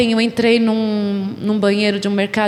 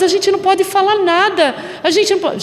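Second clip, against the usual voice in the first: much higher, insistent imitation; protesting reply; 9 semitones higher than usual. Women answering the protest, high-pitched helplessness. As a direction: repeat their complaint in a high, pressing voice.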